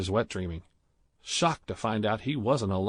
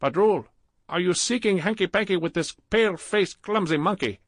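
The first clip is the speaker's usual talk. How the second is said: put-on high, loud scolding Indian accent; berating; 10 semitones higher, 4 dB louder than usual. A narrator's reading. Clerk Gupta berating Badrul, loud scolding Indian accent. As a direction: tear into him like a jealous lover. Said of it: "started to berate Badrul".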